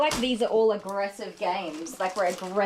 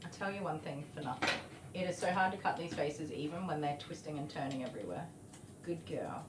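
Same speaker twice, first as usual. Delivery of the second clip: soothing; 16 dB quieter than usual. Real, not acted. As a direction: hush and reassure a squirming pet. Soothing dog while grooming.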